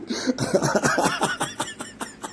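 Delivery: very high breathy squeal; squealing laugh. Real, not acted. Host cracking up at his sales pitch, squealing laugh.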